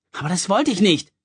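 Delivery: desperate cry. A narrator's reading. Louis cries out in despair to his mother. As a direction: cry out in dismay at oversleeping. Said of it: "rief er verzweifelt".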